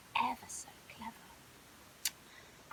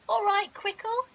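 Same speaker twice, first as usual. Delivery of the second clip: lion cub voice; 8 semitones higher, 12 dB louder than usual. Acted. Lion cub Leo greets.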